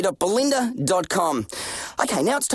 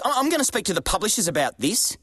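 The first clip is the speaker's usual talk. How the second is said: usual speaking voice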